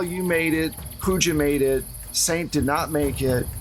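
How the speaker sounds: ordinary talk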